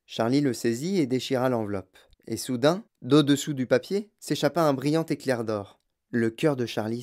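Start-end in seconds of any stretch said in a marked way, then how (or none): none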